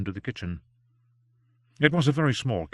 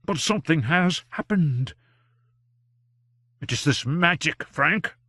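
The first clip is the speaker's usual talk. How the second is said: higher, anxious fatherly doctor voice; worried old doctor; 6 semitones higher, 3 dB louder than usual. A narrator's reading. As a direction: plead with him kindly to come inside. Greysteele voiced by reader.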